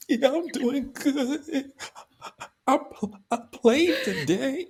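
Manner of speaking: put-on childlike phone voice holding back tears; small boy on the phone. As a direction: squeak it out like a young boy talking to his mom. Voicing himself as a kid on the phone.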